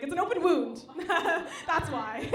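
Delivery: half-laughing retort; laughing through it. Retold or imitated. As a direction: laugh while explaining the wound. Her own reply, laughing.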